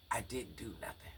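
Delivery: put-on hushed whisper of a scared child's words; whispered quote. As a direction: whisper the boy's plea, barely audible. Quoting the boy's frantic plea.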